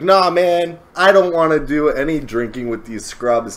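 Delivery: Sasaki. Retold or imitated. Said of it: "he's like".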